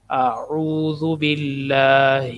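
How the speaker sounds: اعوذ باللہ slowly with a thin lam; careful recitation. Shown as a demonstration. Teacher demonstrating correct pronunciation, slow recitation. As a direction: recite slowly, every letter clear. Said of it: "ہا موٹی ہا ہے".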